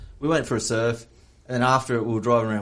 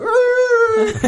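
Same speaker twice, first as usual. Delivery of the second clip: very high, loud screech of a car; imitated screech; 7 dB louder than usual. Second police car pulls up in front, imitated screech. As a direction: squeal like a car flying up.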